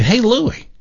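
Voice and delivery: high-pitched holler to a friend; yelling across street. Acted. Man spots old buddy across the street, yelling hello. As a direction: call out brightly across a street. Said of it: "he yells across the street".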